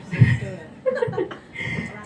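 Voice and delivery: airy high laugh; breathy laugh. Real, not acted. Laugh alone. (tawa lepas tanpa suara)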